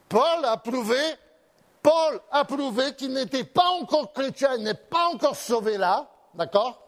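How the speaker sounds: loud, high preaching voice; fervent preaching. Acted. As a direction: proclaim it to the congregation. Preaches about Paul with force. (le pasteur proclame à pleine voix devant l'assemblée)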